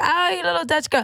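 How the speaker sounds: imitating strangers. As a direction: play someone sizing up a girl. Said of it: "but they be like".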